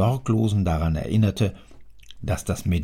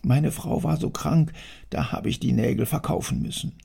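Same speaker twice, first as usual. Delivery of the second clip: higher, half-whispered plea; pleading worker; 6 semitones higher, 3 dB quieter than usual. Acted. Worker begging the angry factory owner, timid and high. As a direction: beg for pity, frightened and small.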